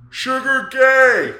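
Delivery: long, drawn-out high yell; teenage heckler. Retold or imitated. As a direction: holler a slow taunt like a teenager. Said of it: "fifteen-year-old teenager goes".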